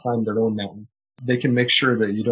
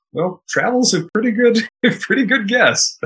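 higher pitch, breaking into a laugh; amused laughing; 9 semitones higher, 3 dB louder than usual. Real, not acted. Speaker guessing which business got hit hardest, amused laughing. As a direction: chuckle at the obvious answer.

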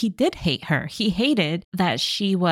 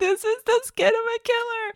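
high, mocking repeat of the line; mocking high echo. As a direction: parrot it back in a squeaky voice.

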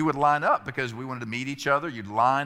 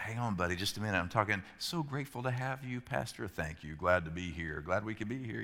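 softer, lower absent-minded murmur; brushing off his son while greeting people; distracted murmur; 5 semitones lower, 8 dB quieter than usual. Pastor half-listening to congregants.

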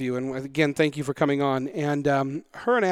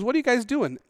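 lightly voiced, higher-pitched woman asking; curious woman; 9 semitones higher than usual. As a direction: retell her question in a higher voice. Voices the woman approaching the cars.

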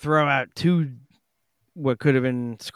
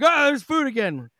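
much higher, cheery shark voice; silly shark voice; 12 semitones higher, 3 dB louder than usual. Pretend. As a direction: voice a happy, dim shark. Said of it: "be like".

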